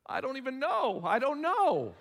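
high, loud, hammed-up wailing; wife sobbing that she doesn't know why, hammed up; mock crying. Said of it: "they're crying like crazy".

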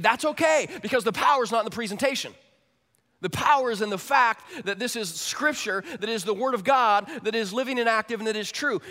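loud, high reassurance; full-force preaching. Real, not acted. Pastor reassuring his congregation, loud and high. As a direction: preach it out, big and certain.

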